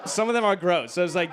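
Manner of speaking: ordinary talk, nothing marked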